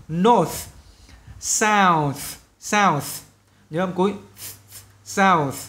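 "North" and "South" with voiceless final TH; final sound demo. Shown as a demonstration. Teacher showing the voiceless final sound, careful demo. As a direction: stress the unvoiced TH ending. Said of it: "nhớ cái âm cuối cho mình này, âm vô thanh này".

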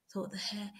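plain reading voice

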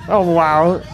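ordinary talk, nothing marked

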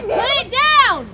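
loud and slow, drawn out; stern order. Real, not acted. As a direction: bark it out slowly. Repeats the order, louder.

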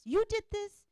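high accusing jabs, fading; finger-pointing blame. Shown as a demonstration. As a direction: blame him item by item. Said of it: "we start pointing fingers".

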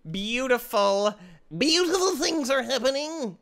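high, loud, drawn-out beautiful; gleeful sing-song. Real, not acted. Gamer delighting in the chaos. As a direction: savour it, stretched out.

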